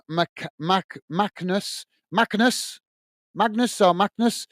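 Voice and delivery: halting, questioning tries at "Magnus"; unsure pronunciation. Real, not acted. Host puzzling over a player's name, unsure pronunciation. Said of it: "I can't pronounce his name".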